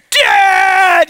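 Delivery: high, loud drawn-out shout of dad; child shouting. Retold or imitated. Son shouting for his dad, high and loud. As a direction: wail for dad at full volume.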